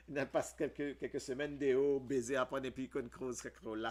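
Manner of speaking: laughing. Real, not acted.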